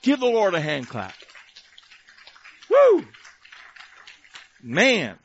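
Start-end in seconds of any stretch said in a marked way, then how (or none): 2.7-3.0 high, loud "woo"; exultant whoop. Real, not acted. Preacher after calling for a hand clap, exultant whoop. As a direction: whoop loudly over the applause.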